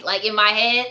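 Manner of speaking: ordinary talk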